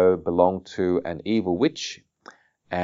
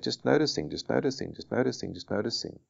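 gentle mindful repetition, growing softer; softly fading repetition; 3 semitones higher, 8 dB quieter, faster than usual. Shown as a demonstration. Teacher models mindful noticing to listeners, softly fading. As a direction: let each one drop quieter, like thoughts passing.

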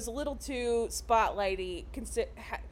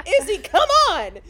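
mock urgent call; 6 semitones higher, 11 dB louder than usual. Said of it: "Becca's like".

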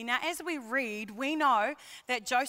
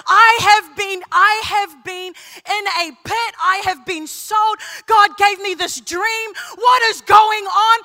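impassioned shout; 8 semitones higher, 15 dB louder than usual. Acted. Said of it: "voicing Joseph's temptation".

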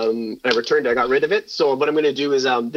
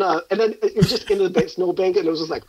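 ordinary delivery

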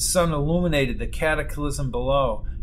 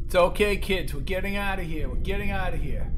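higher-pitched, acted urgency; urgent reassurance; 4 semitones higher than usual. Father getting the kids out of the crumbling town, urgently reassuring. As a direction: hurriedly reassure the frightened kids.

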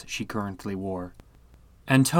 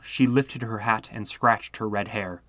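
ordinary delivery

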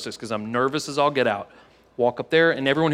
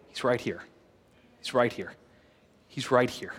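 hushed reassurance; 3 semitones lower, 7 dB quieter than usual. Real, not acted. Pastor assuring congregation God is near.

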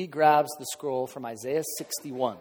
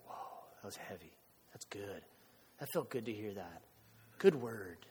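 very soft, low murmur; barely voiced, reverent reactions; hushed awe; 6 semitones lower, 14 dB quieter than usual. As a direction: imitate an awed room murmuring under its breath.

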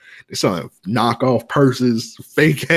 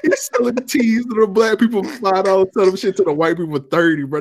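laughing disbelief; 10 semitones higher than usual. Retold or imitated. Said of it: "I'm like".

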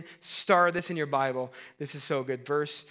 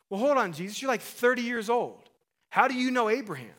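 mock Pharisees; 7 semitones higher, 3 dB louder than usual. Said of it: "And they're like".

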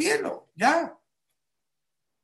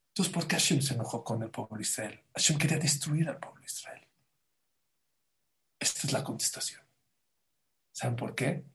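hushed whisper; 3 semitones lower, 7 dB quieter than usual. Real, not acted. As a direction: drop to a breathy secret whisper. Confides key answer to congregation.

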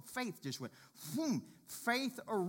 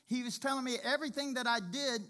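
normal talking voice

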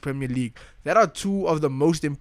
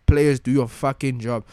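ordinary talk, nothing marked

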